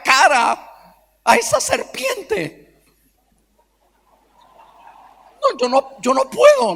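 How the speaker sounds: hammed-up horror at the stepmother; mock outraged shriek. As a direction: squeal it out, half laughing, in disgust. Son rejects father's new wife.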